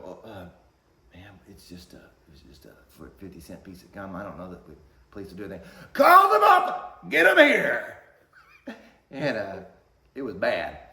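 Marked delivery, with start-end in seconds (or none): 0.0-5.7 much softer, lower hesitant voice; almost whisper, appeasing; timid manager. Retold or imitated. Manager nervously reasoning with the angry mother, quiet. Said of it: "the manager's at this point like". 5.9-8.0 much higher, screaming angry mom; furious shout. Retold or imitated. Mom demanding the manager call police, furious shout.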